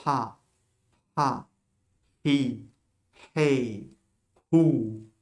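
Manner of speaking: "ha, hi, hey, hou", each opened by a puff of breath; aspirated H. Shown as a demonstration. Teacher demonstrates the letter with each vowel, breathy aspirated H. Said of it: "semblable à un H aspiré".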